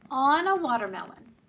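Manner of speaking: plain reading voice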